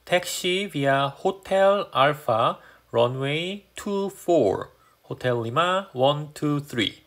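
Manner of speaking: very slow, careful pilot readback; radio readback. Shown as a demonstration. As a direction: read each word back like on the radio. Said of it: "여기서 해준 대로 그대로만 리드백 해주시면".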